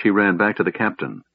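plain reading voice

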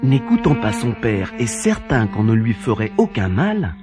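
ordinary delivery

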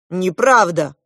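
loud, heartfelt objection; ardent protest. A narrator's reading. Klop contradicting the goose about love, ardent protest. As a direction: burst out in disagreement. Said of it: "пылко возразил Клоп".

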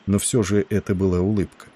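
ordinary delivery

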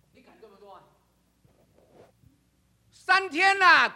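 normal talking voice

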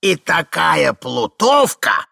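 put-on loud, high, drawn-out old voice; gleeful old man. A narrator's reading. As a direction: savour the gossip slowly. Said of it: "продолжал старик".

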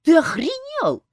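high, loud, slow outraged yell. A narrator's reading. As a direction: scream at him in shock. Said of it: "заорала Ольга".

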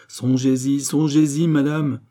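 high, loud, insistent repetition; urgent appeal. A narrator's reading. Gilbert warns queen of lost hours, urgent appeal. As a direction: press harder on the second urging.

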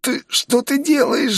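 slow, very high strained wheeze; strangled, high voice of a man in agony; pained wheeze. A narrator's reading. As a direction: choke it out high, as if being crushed. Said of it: "захрипел он".

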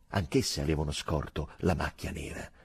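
plain reading voice

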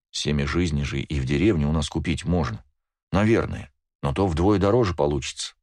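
ordinary delivery

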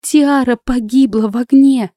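high, strained confession; anguished moan. A narrator's reading. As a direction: wail it out in distress. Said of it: "простонала".

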